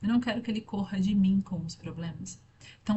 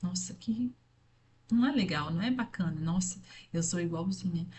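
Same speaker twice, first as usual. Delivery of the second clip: disgusted aside; 5 dB quieter than usual. Retold or imitated.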